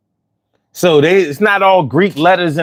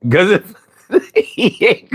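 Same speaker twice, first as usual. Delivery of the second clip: ordinary talk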